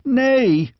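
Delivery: high, despairing protest; anguished cry. A narrator's reading. Squire tells the king the army is broken, high anguished cry. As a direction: cry out in grief, lightly. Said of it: "cried the squire".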